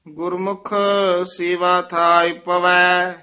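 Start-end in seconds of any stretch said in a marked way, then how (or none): none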